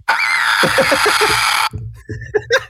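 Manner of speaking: very high mock mermaid scream; mermaid shriek. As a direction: shriek like a mermaid, then crack up. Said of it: "That was, that was uncanny".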